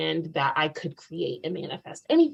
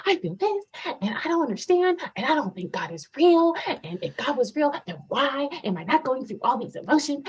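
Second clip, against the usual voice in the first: much higher, pleading words spilling out; a breaking, desperate rush of doubt; retold tearful outburst; 8 semitones higher than usual. Telling the retreat group her doubt about God, tearful and high. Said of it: "word vomited".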